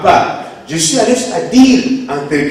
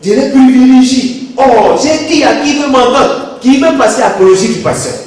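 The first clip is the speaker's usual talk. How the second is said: louder, insistent pleading from the pulpit; forceful preaching; 5 semitones higher, 7 dB louder than usual. Real, not acted. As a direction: drive it home to the congregation.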